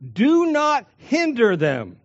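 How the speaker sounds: a stern divine order, slowed; commanding. Shown as a demonstration. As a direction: order it, hitting "Do" high and firm. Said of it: "he commands them".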